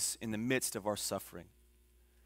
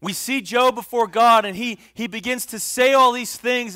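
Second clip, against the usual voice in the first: loud, high, rising sermon delivery; full-force preaching; 7 semitones higher, 11 dB louder than usual. Acted. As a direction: preach it out to the back row.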